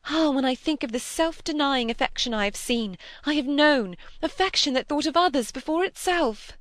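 high-pitched, fervent and energetic; impassioned outburst. A narrator's reading. Cynthia contrasts another's selfless affection with Preston's, in an impassioned outburst. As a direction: pour it out with rising fervour. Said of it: "breaking out with fresh energy".

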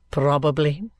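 low, level echo; dry, subdued lawyer. Acted. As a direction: answer low, giving nothing away. Vholes echoes Kenge's answer.

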